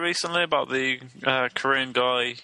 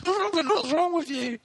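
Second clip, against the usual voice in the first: high mock voice. Mock voice of Robin.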